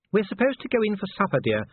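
high-pitched, ladylike wife's voice; Sybil, genteel wife. A narrator's reading.

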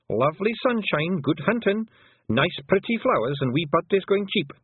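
high, slow Scottish pixie voice, untroubled; a wee Scots fighter shrugging off death; Scots pixie warrior. A narrator's reading. Rob Anybody shrugging off death to Tiffany, high Scots pixie voice.

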